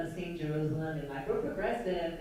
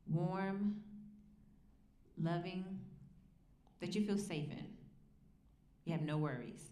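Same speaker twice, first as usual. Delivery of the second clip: soft, calm guiding voice, softer and unhurried; guided visualization; 6 dB quieter than usual. Acted. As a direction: ease them into picturing a safe place. Leads a visualization.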